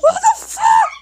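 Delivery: squeaky high put-on voice; silly voice. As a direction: say it in a goofy cartoon voice.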